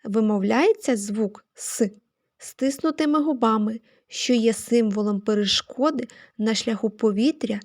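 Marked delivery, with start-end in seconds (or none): 1.5-1.9 hard, non-palatalised «с», isolated; hard S sound. Shown as a demonstration. Teacher explaining how the sound is articulated, hard «с». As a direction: give «с» as a plain hard consonant. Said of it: "приголосний твердий".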